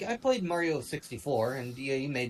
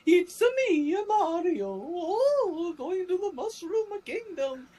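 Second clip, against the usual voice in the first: very high cartoon Italian falsetto; mock the squeaky game-hero voice, drawn out and hammed-up; Mario; 4 dB louder, slower than usual. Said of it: "can't imagine watching an entire movie of".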